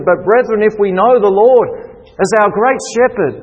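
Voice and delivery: rally the brethren against fear; rousing preaching. Acted. Preaching at full force.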